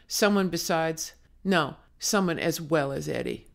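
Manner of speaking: ordinary delivery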